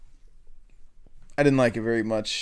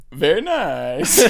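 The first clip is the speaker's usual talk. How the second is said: very slow, drawn-out catchphrase imitation; Borat; 3 semitones higher, 4 dB louder, much slower than usual. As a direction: stretch it out in a comic foreign accent. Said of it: "I was really excited for Borat".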